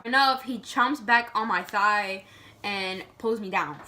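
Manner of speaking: ordinary delivery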